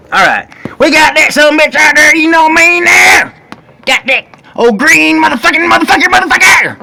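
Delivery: high, loud hyped rallying cry; roaring rallying speech. Acted. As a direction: pump everyone up, loudly. Hyping up the job.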